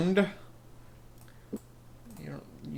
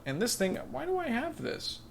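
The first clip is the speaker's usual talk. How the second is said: high, baffled question; real puzzled surprise at the item; surprised; 10 semitones higher, 5 dB quieter than usual. Real, not acted. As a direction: pitch up in disbelief. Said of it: "surprised at inventory item".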